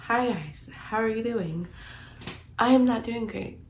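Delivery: ordinary talk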